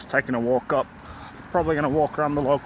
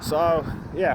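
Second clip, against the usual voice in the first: usual speaking voice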